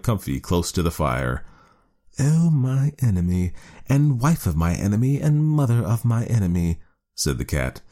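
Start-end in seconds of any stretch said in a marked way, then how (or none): 2.2-6.8 snooty bored cat. A narrator's reading. Cat voiced in story.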